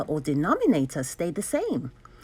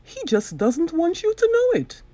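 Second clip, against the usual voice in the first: slightly higher, louder, prim teacherly lady; a lady mathematician explaining, a touch higher; Madame's voice; 9 semitones higher, 4 dB louder than usual. Acted. Madame explains the fractions, lightly voiced lady.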